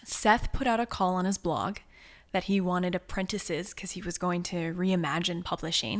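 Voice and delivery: normal talking voice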